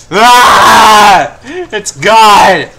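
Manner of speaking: real dismayed yell. Real, not acted. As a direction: cry out as it slips away. Loses the item, yells.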